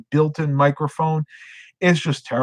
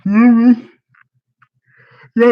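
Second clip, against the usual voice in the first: mid-yawn hum; 9 semitones higher, 9 dB louder than usual. Real, not acted. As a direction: yawn through it. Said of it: "count how many times I actually yawn".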